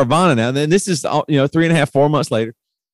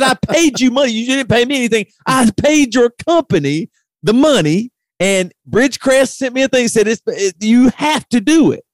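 retold exasperated outburst; 9 semitones higher than usual. Retold angry phone call.

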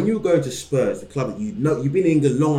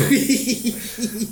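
high-pitched breathy giggle; 7 semitones higher than usual. Real, not acted. Laughter at own joke.

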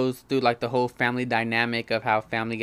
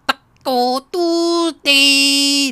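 much higher, louder, slow teasing call-out; mock disbelief; 10 dB louder, much slower than usual. Teasing Anthony Davis about saying Taco Tuesday, higher, louder and slow. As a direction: drag it out like you caught him.

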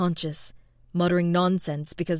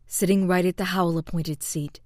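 ordinary delivery, nothing marked

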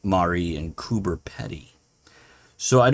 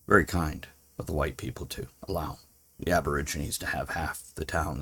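dry, quiet sarcasm; sarcastic; 4 semitones lower, 8 dB quieter, faster than usual. Real, not acted. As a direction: murmur it with biting irony. Sarcastic remark on colonial history.

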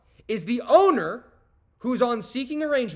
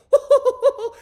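loud, high laugh at himself; sudden hearty laugh; burst of laughter; 9 dB louder than usual. Real, not acted. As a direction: laugh out at your own foolishness. Laughing at his past self.

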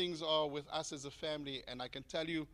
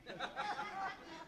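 a sudden squeaky giggle, much higher; high burst of laughter; 14 semitones higher, 7 dB quieter than usual. Real, not acted. Laughing at his own toothless joke to the congregation, high giggle. Joking about preaching toothless.

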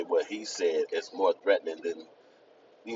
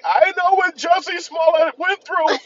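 high falsetto mock woman, drawn out; high mock voice of Gail crying victim; 8 dB louder, slower than usual. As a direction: squeal it like a dramatic victim. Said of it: "She'll be like".

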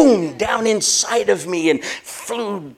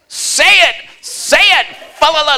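much higher, loud yell of say it; shouting urging; 9 semitones higher, 7 dB louder than usual. Retold or imitated. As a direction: yell at him to say it. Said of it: "And she's".

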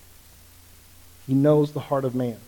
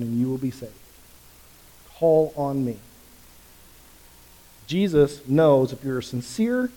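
normal talking voice

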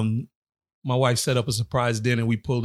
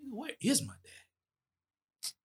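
much higher, very soft and slow, lost; stunned sad dad-less kid; 10 semitones higher, 14 dB quieter, much slower than usual. Retold or imitated. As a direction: ask it like a kid staring at the TV, barely breathing. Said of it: "sat back like".

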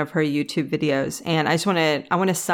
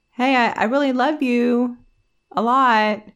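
higher, louder mock call across the water; retold yell; 7 semitones higher, 4 dB louder than usual. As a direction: call it out across the lake to him. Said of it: "she yelled it again".

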